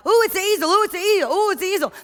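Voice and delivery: high, loud, thrilled squealing; children rushing up delighted; excited kids. Retold or imitated. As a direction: gush like children spotting a treat. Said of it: "forty-nine kids go running up and are like".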